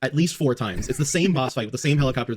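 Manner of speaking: usual speaking voice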